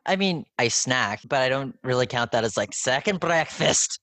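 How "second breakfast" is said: slow, higher mock Hobbit accent; hammed-up Hobbit accent; terrible Hobbit accent. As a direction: put on a bad Hobbit accent, drawing the words out. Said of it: "that was a terrible Hobbit accent".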